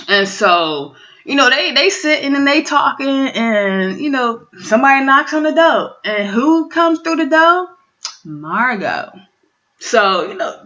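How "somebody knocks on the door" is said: usual speaking voice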